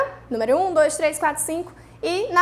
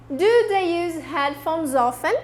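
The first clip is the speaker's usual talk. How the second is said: slow model sentence; 3 semitones higher, slower than usual. Shown as a demonstration. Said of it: "Todo mundo repete".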